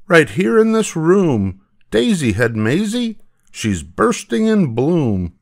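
high, amazed schoolboy exclamation; excited boy's cry. Acted. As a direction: announce the marvel in a high, excited voice. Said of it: "cried Butch".

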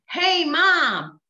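put-on high-pitched child greeting; son on phone. Dream son greets his mom by phone, high child voice. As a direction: imitate a young son calling mom. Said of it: "I hear a voice on the other side".

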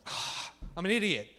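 loud, high, mock-frustrated apology; hammed-up outburst of self-blame; exasperated apology. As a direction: blurt a grudging sorry at high volume. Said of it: "That's usually how my apologies go".

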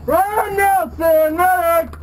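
slow, drawn-out shout for a medic; man down shout. Real, not acted. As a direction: call the name long across the field. Calling for medic.

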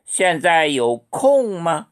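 very slow, each syllable clear; slow model question. Shown as a demonstration. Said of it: "现在有空吗? 이렇게 물어보면".

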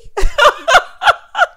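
high, loud cackling laugh; long, whooping laugh; big laugh. Real, not acted. Laughing at Candace's question.

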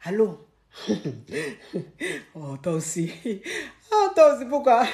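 imitated coy, laughing phone call; giggly phone flirting. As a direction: giggle through a secret call. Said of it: "Et puis tu rigoles".

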